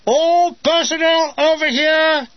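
high, loud, slow officer's summons; calling out. A narrator's reading. Officer summoning the men, a slow carrying call. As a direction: bellow it across to the men.

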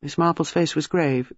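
ordinary delivery, nothing marked

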